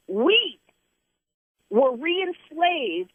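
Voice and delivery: re-enslaved drawn out slowly, high; stress on re-enslaved. Real, not acted. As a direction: lean on the word re-enslaved, very slow. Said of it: "I'm gonna say that word again".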